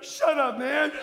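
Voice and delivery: high, loud comic outburst; exasperated retort. Retold or imitated. Worn-out father to his cheering kids, loud comic exasperation. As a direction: snap back in mock exasperation.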